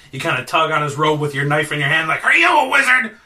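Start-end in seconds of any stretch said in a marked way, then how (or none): 2.2-3.2 higher, louder demand; acted confrontation. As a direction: accost a suspected wizard, loud and high. Demanding question of a stranger at knifepoint.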